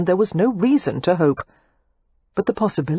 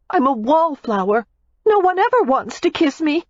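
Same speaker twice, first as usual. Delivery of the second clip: put-on higher, heated young woman's voice; Daisy, furious; 10 semitones higher than usual. A narrator's reading. Daisy snaps back at Matthew in fury. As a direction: hurl the accusation back at him.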